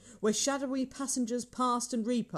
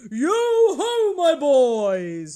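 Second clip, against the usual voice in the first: loud, drawn-out hearty holler; booming, high-pitched jovial call; jovial hail; 5 semitones higher, 10 dB louder, much slower than usual. A narrator's reading. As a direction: hail the lads at full voice. Fezziwig hails his apprentices.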